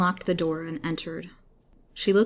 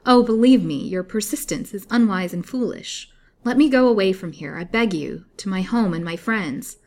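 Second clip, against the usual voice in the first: pleading; 3 semitones higher, 8 dB louder than usual. A narrator's reading. Captive pleads for release.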